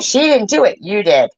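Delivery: sharp, loud and high correction; angry retort. Real, not acted. Listener rebukes a father about his daughter, angrily. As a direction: snap back that it was his fault.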